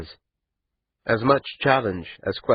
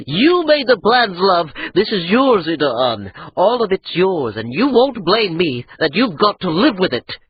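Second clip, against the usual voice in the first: loud, high furious rant; 13 semitones higher, 5 dB louder than usual. A narrator's reading. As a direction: rage at her. Adra's rage, whooping.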